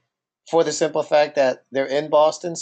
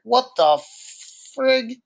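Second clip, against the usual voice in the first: high, baffled and slow; shocked disbelief; 7 semitones higher, slower than usual. Retold or imitated. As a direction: stare at him in confusion. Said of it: "I'm like".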